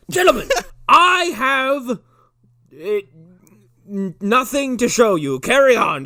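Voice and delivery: loud, high, hammed-up announcement; big mock-theatrical proclamation; giddy lecturer impression. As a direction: burst out grandly, then stall and bail. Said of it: "He's like".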